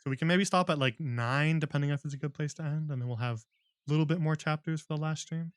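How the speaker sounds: plain reading voice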